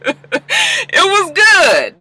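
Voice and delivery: high, drawn-out exclamation after laughing; delighted squeal. Real, not acted. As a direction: squeal your verdict, stretching it slowly.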